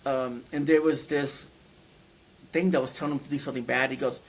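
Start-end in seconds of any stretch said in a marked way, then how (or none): none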